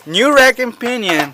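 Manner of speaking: high, punchy hard-sell pitch; used car salesman. Acted. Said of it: "you sound like a used car salesman".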